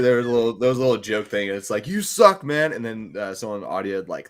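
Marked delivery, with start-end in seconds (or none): 1.9-2.7 high, loud jeering quote; mock heckle. Quoting a joke audio clip, loud high mock heckle. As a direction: call it out like a heckler, pitched up.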